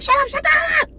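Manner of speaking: very high-pitched imitated screech; furious shriek. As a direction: screech over him to shut him up. Acted quarrel, she cuts him off.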